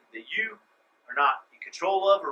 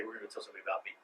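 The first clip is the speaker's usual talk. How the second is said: soft quote; 10 semitones lower, 12 dB quieter, much faster than usual. Retold or imitated. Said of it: "He'd say".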